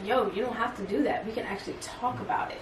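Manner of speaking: calm check-in. Retold or imitated. S2 showing how to calm a heated partner, soft reassuring. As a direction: ease the tension, quiet and calm. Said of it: "it's like to check you".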